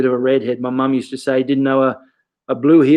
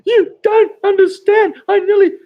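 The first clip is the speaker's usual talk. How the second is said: mock sobbing; 5 dB louder than usual. Crying kid protests to his coach, exaggerated wailing. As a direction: bawl like an inconsolable kid, hammed up. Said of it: "crying like".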